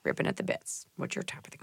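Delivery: whispered creepy; whispering creepily to the co-host. Pretend. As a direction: hiss it in a spooky whisper.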